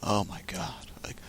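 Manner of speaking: hushed, whispered disbelief; imitating a friend's whispered exclamation; under the breath. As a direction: whisper it as if muttering to yourself. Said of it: "you said under your breath".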